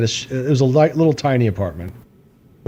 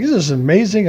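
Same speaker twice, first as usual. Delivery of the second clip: mock amazement; 8 semitones higher than usual. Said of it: "And he's like".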